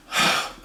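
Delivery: long breathy sigh; exasperated sigh. Acted. Customer fobbed off by barman, exasperated sigh. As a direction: let out a heavy, weary breath.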